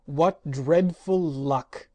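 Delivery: slow, loud wail; despondent wail. Acted. Magician lamenting the live phonograph, slow despairing wail. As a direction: moan it in despair. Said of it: "he wailed despondently".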